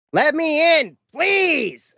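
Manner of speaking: high, loud imitated angry plea; mock her furious pounding-at-the-door yell; shrill-sounding mock shout of let me in. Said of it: "yelling angrily, 'Please,'".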